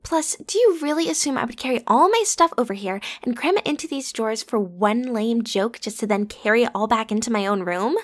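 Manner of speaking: normal talking voice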